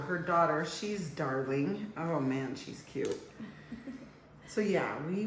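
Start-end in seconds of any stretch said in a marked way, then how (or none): none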